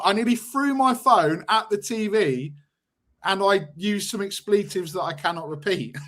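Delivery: ordinary talk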